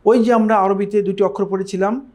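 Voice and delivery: ordinary talk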